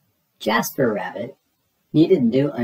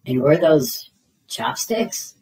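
plain reading voice

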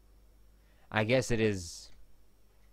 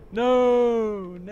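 screaming; 10 semitones higher, 4 dB louder than usual. Pretend. Dragged up again.

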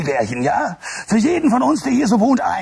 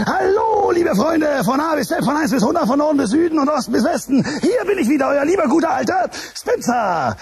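excited greeting; 6 semitones higher than usual. Acted. Host welcoming children to his show, high excited shout. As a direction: throw open your arms and greet the kids.

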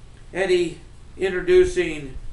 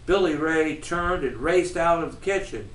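usual speaking voice

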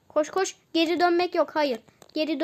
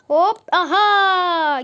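triumphant shout; 3 semitones higher, 7 dB louder, much slower than usual. Real, not acted. Gamer crowing after finally passing the level. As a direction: crow over the win.

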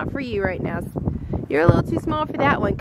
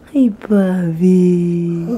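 very slow drawn-out cooing hello; 6 semitones lower, 6 dB louder, much slower than usual. Real, not acted. Mom cooing hello to her baby son.

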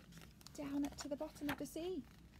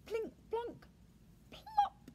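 high, separated plinky noises; falling sound effects; 11 semitones higher, slower than usual. Acted. Reader imitating the bouncing pearl, high plinky sound effects. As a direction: pop each word out high, plop highest.

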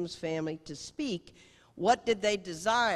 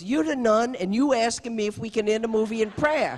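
louder, higher teasing retort; mock exclaiming; 6 semitones higher, 9 dB louder than usual. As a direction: scoff at her question, loud. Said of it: "And he goes".

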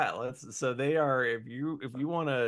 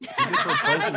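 laughing; 8 dB louder than usual. Real, not acted. Laughing at the joke about David.

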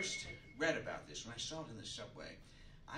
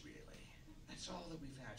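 breathy awed whisper, much softer; 7 dB quieter than usual. Acted. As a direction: lean in and whisper the wonder. Awed cosmic musing.

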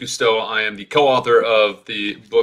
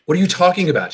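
spat; 8 semitones higher, faster than usual. Acted. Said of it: "Sheridan spat, afraid but angry".

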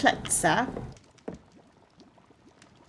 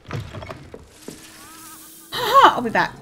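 laughing through a louder, higher promise; 11 semitones higher, 4 dB louder than usual. Real, not acted. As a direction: giggle out a playful threat to return.

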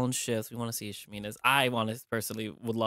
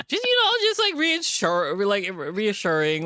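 laughing; 7 semitones higher than usual. Real, not acted. Laughing while explaining.

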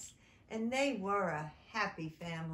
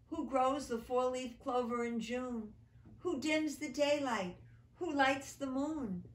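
raised, declaiming poem voice; stage recital of a poem; 4 semitones higher, 4 dB louder than usual. Acted. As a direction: recite it to an audience, grand. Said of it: "as if from a stage".

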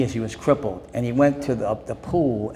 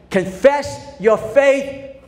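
slow drawn-out declaration; 12 semitones higher, 4 dB louder, much slower than usual. Retold or imitated. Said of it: "I say".

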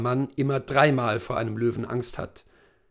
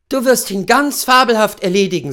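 high, louder wife's voice; encouraging wife; 8 semitones higher, 9 dB louder than usual. Acted. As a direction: cheer your husband on before the hunt. Voices wife encouraging her husband. (hohe, anfeuernde Ehefrau)

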